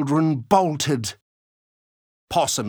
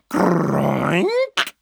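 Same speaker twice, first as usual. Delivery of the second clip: grunting pig noise; 4 semitones higher, 4 dB louder than usual. Acted. Unseen animal noises in bushes.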